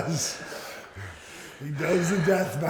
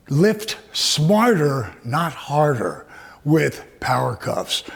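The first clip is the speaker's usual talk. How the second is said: ad slogan read; 3 semitones lower, slower than usual. Acted. Closing product slogan.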